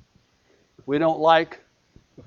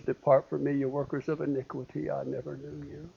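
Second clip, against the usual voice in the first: grave hushed pronouncement; 2 semitones lower, 11 dB quieter than usual. Acted. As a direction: sink softly into near silence. God's final rejection, voice sinking.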